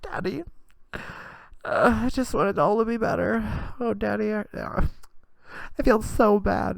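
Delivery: put-on high, wailing teenage girl; whiny teen daughter. Daughter wailing to her dad about Blake, high and whiny. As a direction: sob it out like a heartbroken teenager. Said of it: "She's like".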